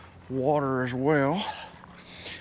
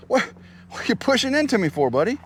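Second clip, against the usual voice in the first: playful surprised question; 10 semitones higher than usual. Real, not acted. Horse nudging him.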